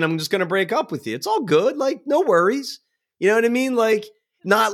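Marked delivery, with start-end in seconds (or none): 0.0-2.8 breezy breakup. Retold or imitated. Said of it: "like, saying".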